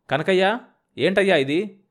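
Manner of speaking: shouting. A narrator's reading. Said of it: "అని అరిచాడు".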